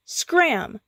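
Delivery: sharp high shout to drive bullies off. A narrator's reading. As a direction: chase them off with one word. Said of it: "Skips shouted".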